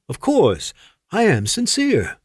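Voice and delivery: high, overdone fake sincerity; sarcastic. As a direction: lay the insincerity on thick. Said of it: "in a tone that indicated anything but".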